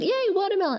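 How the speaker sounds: high, delighted little cheer; excited cheer. Retold or imitated. Coming down to the fridge for watermelon, excited cheer. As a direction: cheer as if a treat awaits.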